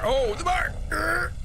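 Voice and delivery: high-pitched outburst into a groan; exasperated realization. Real, not acted. Player remembers where to look at the bar, exasperated. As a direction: cry out as it dawns, then growl.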